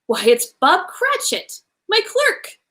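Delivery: slowly, then a sudden high my clerk; startled to recognise his clerk; with surprise. A narrator's reading. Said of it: "he said with surprise".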